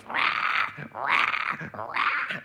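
loud harsh mock baby wail; three short loud wails imitating a baby; imitated baby crying. Preacher imitating a crying baby for the congregation, loud mock wail. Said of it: "that baby goes".

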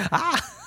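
mock bite snarl.

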